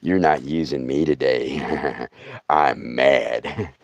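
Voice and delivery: low, slow sulky thumb; grumpy thumb voice. Retold or imitated. The injured thumb refusing to be used, low sulky grumble. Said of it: "That thumb says".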